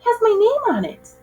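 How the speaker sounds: put-on high, thrilled boy voice; amazed to see his own name; excited kid. A narrator's reading. Boy pulls football book from magician's hat, thrilled.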